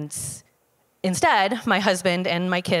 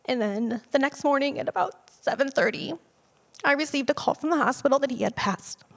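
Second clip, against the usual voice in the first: choked-up whisper; 8 semitones higher than usual. Real, not acted. Daughter recalls the call about her dad, tearful whisper. Telling of her father's death.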